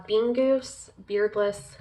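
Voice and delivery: ordinary talk, nothing marked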